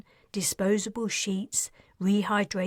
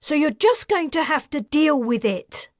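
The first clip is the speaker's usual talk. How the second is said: sharp, raised and angry; 7 semitones higher, 6 dB louder than usual. Acted. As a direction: snap it out in anger. Angry partner ends the call.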